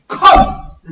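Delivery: loud director's call; director's shout. Retold or imitated. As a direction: bark it like a director stopping the take. Director yelling on set.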